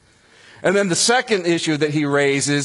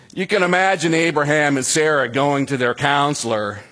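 normal talking voice

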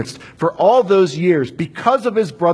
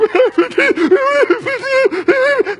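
high, loud mock wail of weeping; imitating Joseph's loud weeping; mock sobbing wail; 5 dB louder than usual. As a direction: wail high like a man breaking down. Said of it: "here's Joseph just like".

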